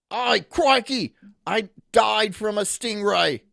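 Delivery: high, loud, drawn-out mock Australian accent. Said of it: "give us your best Australian accent".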